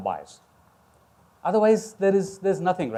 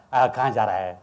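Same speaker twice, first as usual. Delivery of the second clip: lightly imitating a South Indian accent in Hindi, lower; mock South Indian Hindi accent; 4 semitones lower, much faster than usual. Mocking how South Indians are shown speaking Hindi, in a light mock South Indian accent. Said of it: "real Hindi speaking South Indians don't speak like".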